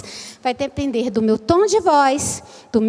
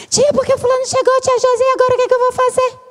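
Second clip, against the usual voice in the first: put-on high-pitched, racing child voice; frantic fast child; 9 semitones higher, much faster than usual. Visual child running to tell the teacher, frantic and fast. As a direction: rattle it off like a desperate kid. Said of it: "correndo desesperado".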